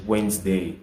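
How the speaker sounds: Wednesday as wensday, middle letters silent; correct pronunciation. Shown as a demonstration. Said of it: "On dit".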